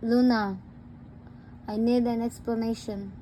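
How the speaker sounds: ordinary delivery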